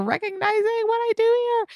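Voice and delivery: very high, squeaky mock gush; gushing thanks. Thanking them for recognizing her work, squeaky gushing.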